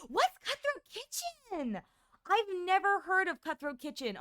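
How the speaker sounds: mock disbelief. Mock disbelief at unknown show.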